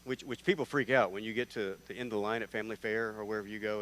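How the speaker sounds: ordinary talk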